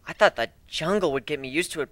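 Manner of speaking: ordinary delivery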